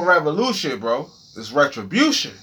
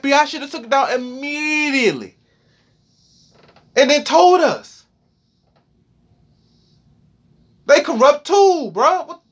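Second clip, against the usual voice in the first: upset; 7 semitones higher than usual. Real, not acted. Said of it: "Holy smokes, bro".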